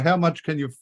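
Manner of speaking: ordinary talk, nothing marked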